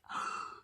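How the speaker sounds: soft, whispered gasp; quick hushed intake of breath in mock dismay. Pastor showing children the draining tank, dismayed gasp.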